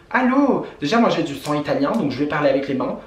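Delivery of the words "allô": exasperated. Real, not acted. Exasperated call-out.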